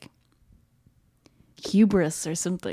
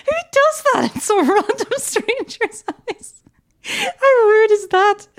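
high, laughing disbelief, slowing at the end; laughing mock outrage; 6 dB louder, slower than usual. Telling a friend about a rude houseguest, laughing in mock outrage. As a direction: giggle through a scandalised protest. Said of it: "I was like".

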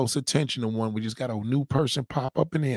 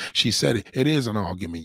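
usual speaking voice

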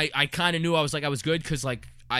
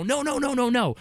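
mock scream; 8 semitones higher than usual. Retelling friends screaming a warning, high mock scream. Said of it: "I hear 'em scream and go".

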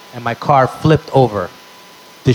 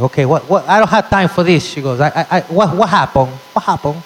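put-on flustered Spanish-accented English, pitched up; mock frantic accent; mom's accent; 4 semitones higher than usual. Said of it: "she's like".

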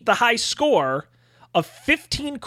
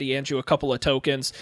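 usual speaking voice

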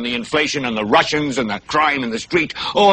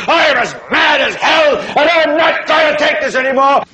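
furious shout; 9 semitones higher than usual. Acted. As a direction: scream it so the whole city hears. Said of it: "You've gotta say".

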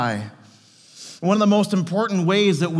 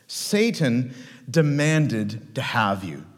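very slow, weighty delivery; grave pronouncement; 5 semitones lower, much slower than usual. Acted. Jesus warns Simon Peter of Satan, slow and grave. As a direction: spell out the threat slowly, word by word. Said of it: "he said, "Simon, Simon, behold".

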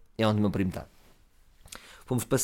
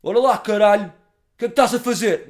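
much higher, much louder bellowing hard man; tough-guy voice; 10 semitones higher, 10 dB louder than usual. Retold or imitated. Confronting the drunk with a bottle, roaring hard man. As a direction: roar it like a street brawler. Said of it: "faço voz".

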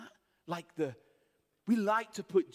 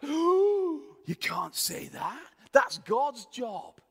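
high shocked cry, then slow and louder; scandalised onlooker, slowing to a firm protest; shocked outcry; 6 semitones higher, 7 dB louder, much slower than usual. Retold or imitated. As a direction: gasp out that it's blasphemy. Crowd shocked at forgiveness.

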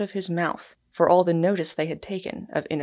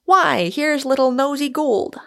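higher, louder, hearty greeting; jovial, flippant exclamation; hearty flippancy; 7 semitones higher, 5 dB louder than usual. A narrator's reading. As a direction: exclaim it with breezy good humour. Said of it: "heartier outbursts of a healthy and humane flippancy".

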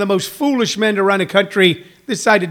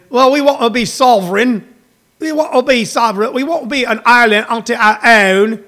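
raised, insistent British put-on; mock British voter; 4 semitones higher, 3 dB louder than usual. Comic mimicking Brexit voters demanding sovereignty, mock British. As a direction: declare it in a higher mock British voice.

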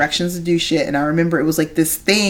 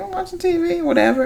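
mom's bored reply; 12 semitones higher than usual. Retold or imitated. Mom answering her kid, mock distracted.

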